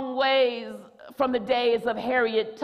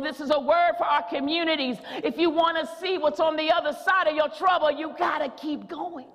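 ordinary delivery

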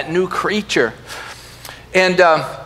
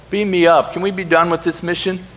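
ordinary delivery

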